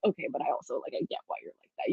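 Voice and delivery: fast, soft whispered confession; hushed rushed aside. Retold or imitated. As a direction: murmur it quickly, confiding. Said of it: "But also you're like".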